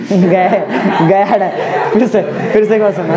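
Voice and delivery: laughing. Real, not acted. Teacher laughing at class.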